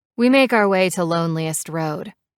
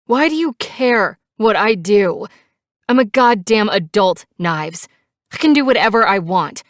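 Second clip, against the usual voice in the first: higher, loud, indignant outburst; angry, defiant; 4 semitones higher, 7 dB louder than usual. A narrator's reading. Passenger telling biker she's an adult, defiant shouting. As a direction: insist angrily, voice raised. Angry retort continues in quarrel.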